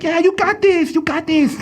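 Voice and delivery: high, mock cheerleader encouragement; hammed-up goofy Hitler cheering. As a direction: cheer the kid on like a silly coach. Imitating Hitler cheering Jojo on.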